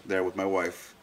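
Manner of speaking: ordinary talk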